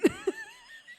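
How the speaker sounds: a shrill burst of laughter; real high giggle; high squeal laugh. Real, not acted. Laughing about brethren.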